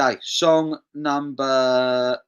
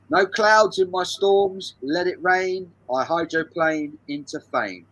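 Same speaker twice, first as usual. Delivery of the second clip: slow, higher and louder, each word spelt out; slow lyric reading; 5 semitones higher, 3 dB louder, slower than usual. Shown as a demonstration. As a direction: read the lyrics out slowly for the quiz. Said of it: "Here's the lyrics".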